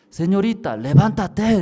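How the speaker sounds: high, loud call to wake up; a voice calling someone out of sleep. Retold or imitated. Mysterious voice calling her.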